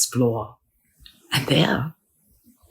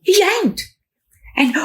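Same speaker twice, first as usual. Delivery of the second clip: ordinary delivery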